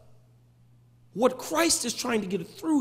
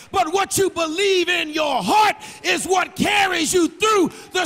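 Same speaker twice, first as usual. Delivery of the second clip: loud, higher sung-out preacher's cadence over music; preaching at full force; 6 semitones higher, 4 dB louder than usual. Acted. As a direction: preach it, ride the organ.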